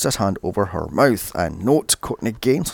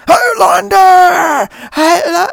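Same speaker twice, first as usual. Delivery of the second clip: mock scream, much higher, louder and very slow, voice cracking; 10 dB louder, much slower than usual. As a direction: belt it out like a deranged cult kid. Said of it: "Malachi is screaming".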